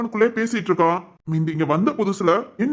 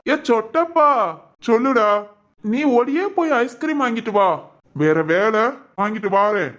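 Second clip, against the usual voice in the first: put-on childlike man; 2 semitones higher than usual. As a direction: talk like a puzzled ten-year-old. Childlike son.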